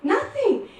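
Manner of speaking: high, loud, aggrieved retelling; playfully hammed-up hurt protest; indignant upset. Retelling her upset at no praise.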